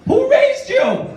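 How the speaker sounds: mock outrage. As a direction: draw it out high like an offended teacher. Teacher scolding student.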